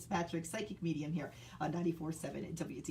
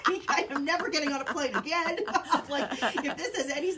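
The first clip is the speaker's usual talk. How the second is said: louder, higher, laughing through the words; 8 semitones higher, 11 dB louder than usual. Real, not acted. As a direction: laugh as you swear off planes. Mom laughing about never flying.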